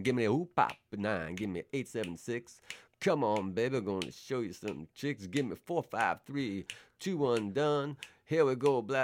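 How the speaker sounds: loud radio DJ countdown rap; DJ patter. Retold or imitated. Imitating the radio DJ counting down on air. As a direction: rattle off the countdown like a late-night DJ. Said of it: "it would just go like that all night".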